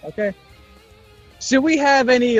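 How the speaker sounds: ordinary talk, nothing marked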